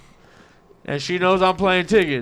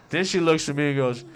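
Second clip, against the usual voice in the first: ordinary talk, nothing marked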